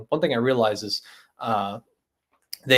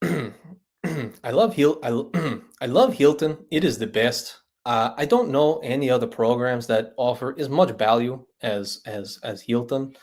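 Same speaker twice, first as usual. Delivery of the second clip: Puerto Rican accent impression. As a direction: praise Hilton in a mild mock Puerto Rican accent. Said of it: "but in English, he's more like".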